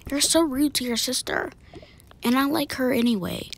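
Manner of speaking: ordinary delivery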